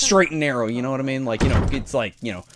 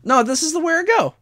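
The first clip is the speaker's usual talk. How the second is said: mock voice; 14 semitones higher than usual. Playful mock voice in game.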